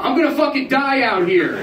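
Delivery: high, loud desperate cry; drunken wail. Retold or imitated. Drunk comic wailing at the club manager, high and loud. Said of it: "shaking one of the managers going".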